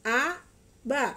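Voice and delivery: A and ba each said loud and slow, apart; over enunciating. Shown as a demonstration. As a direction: sound out each syllable, A then ba. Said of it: "ikutin Mama ya".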